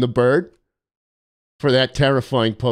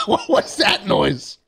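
laughing; slower than usual. Real, not acted. Laughing at a weird noise.

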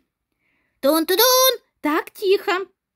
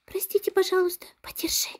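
whispering; 9 dB quieter, faster than usual. Acted. Student whispers excuse at board.

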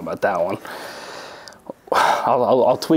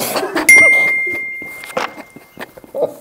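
loud laugh; 4 dB louder than usual. Real, not acted. Laughing at own joke.

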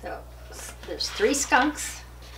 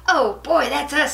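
high, louder burst of glee; thrilled to be asked; excited; 5 semitones higher, 6 dB louder than usual. Acted. Skunks thrilled by invitation.

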